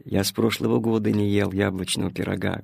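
low, soft and downcast; subdued little sadness, voice dropped; sad boy. A narrator's reading. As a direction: say it quietly, with wistful regret. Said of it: "грустно сказал Тильтиль".

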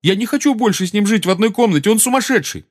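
high-pitched agitated outburst; indignant refusal, pitch held high; heated. A narrator's reading. Seamus refusing to share a room with Harry, heated. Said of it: "разгорячённо сказал ему Симус".

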